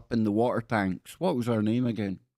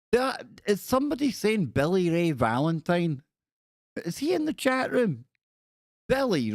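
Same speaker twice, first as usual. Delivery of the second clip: delighted surprise; 8 semitones higher than usual. Real, not acted. Spots a regular in chat.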